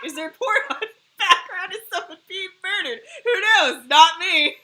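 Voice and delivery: high, laughing through the words. Real, not acted. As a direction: crack up while you ask. Laughing at the noisy background.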